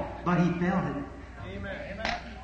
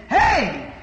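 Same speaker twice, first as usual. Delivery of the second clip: loud, high jeering call up the hill; mocking priests; 6 semitones higher, 3 dB louder than usual. Priests jeering at Jesus passing by, loud high mockery. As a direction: taunt him like a scornful priest yelling from a graveyard. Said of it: "he heard priests saying".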